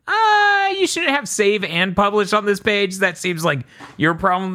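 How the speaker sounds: high, squeaky indignant protest; mock indignant squeal. Arguing with Apple over publishing, high mock indignant squeal. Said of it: "And I'm like".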